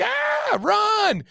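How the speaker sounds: high-pitched mock scream; hammed-up terrified yelp; mock panic. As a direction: pretend to flee shrieking from a bug. Mimics panicking at a bug.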